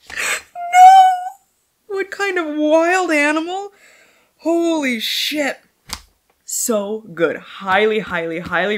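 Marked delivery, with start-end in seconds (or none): 0.1-2.0 high wailing cry of no; loud pained wail; anguished cry. Real, not acted. Reacting to emotional book ending. 2.0-5.6 overwhelmed. Real, not acted. Overcome by book memory.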